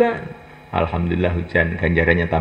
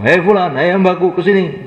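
high, loud calling out to a servant; calling voice of God; 10 semitones higher, 5 dB louder than usual. Pretend. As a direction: call the servant warmly from afar, raised. Allah memanggil hamba, suara diperankan. (suara memanggil hamba, tinggi dan keras)

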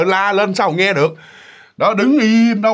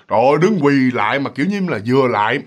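normal talking voice

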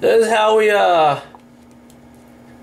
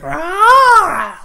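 much higher, louder beast roar; furious roar; 13 semitones higher, 8 dB louder than usual. Retold or imitated. Voicing the furious Luxray card to viewers, mock roar. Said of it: "He's, like, wild furious".

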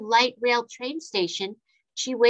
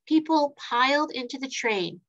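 plain reading voice

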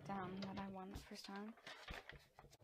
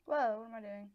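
usual speaking voice

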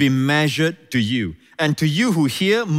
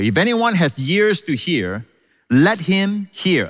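"let him hear" stretched out slowly and pitched high; slow, weighty stress on "let him hear"; emphatic; 5 semitones higher, slower than usual. Real, not acted. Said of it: "Again, the emphasis".

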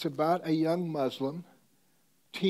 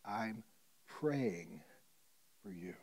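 soft; 4 semitones lower, 6 dB quieter, much slower than usual. Retold or imitated. Retold tender promise.

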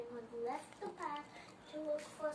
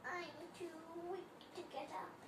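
put-on weak old grandmother; 4 semitones higher, 7 dB quieter than usual. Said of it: "cried grandmother".

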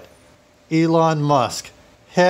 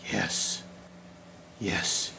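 low, very soft murmur; hushed savouring; 7 semitones lower, 13 dB quieter than usual. Acted. Burglar admiring a painting, hushed savouring. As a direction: say it low, almost under the breath.

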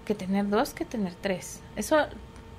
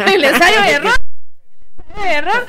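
laughing through the words, louder and higher; 7 semitones higher, 11 dB louder than usual. Real, not acted. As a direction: chuckle it out. Riendo al nombrar al primer hijo. (riendo a carcajadas)

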